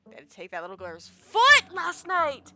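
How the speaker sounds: loud, slow, appalled exclamation; shocked outburst. Acted. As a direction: burst out in disbelief, dragging the words. Acts character protesting shocking event.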